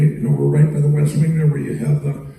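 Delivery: ordinary talk, nothing marked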